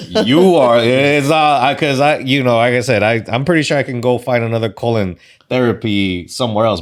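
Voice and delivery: excited praise. Real, not acted. Guest gushing praise of host.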